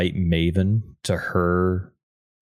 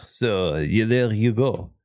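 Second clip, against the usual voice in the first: slow put-on French accent; mock French accent; slower than usual. Canadian closing the joke, mock French accent. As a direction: round it off in fake French-accented English.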